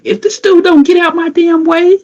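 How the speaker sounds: high, loud irritated rant; worked-up retelling of being mad; getting mad. Retold or imitated. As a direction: let the annoyance climb high and loud. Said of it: "found myself getting kinda mad".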